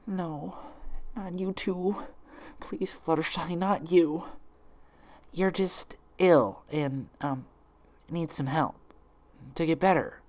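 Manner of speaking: high, soft, slow broken plea; tearful pleading. Acted. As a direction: fight back tears as you beg. Twilight breaks down tearfully.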